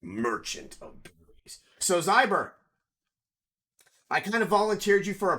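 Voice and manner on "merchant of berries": low, slow, hushed merchant; berry merchant voice. Acted. Giving out berries as a shady game merchant, merchant voice. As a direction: play a merchant, down low and drawn out.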